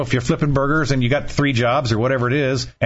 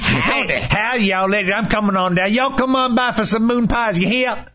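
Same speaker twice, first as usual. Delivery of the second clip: sing-song fake Southern folksiness; mock Southern twang; 8 semitones higher than usual. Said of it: "her southern twang".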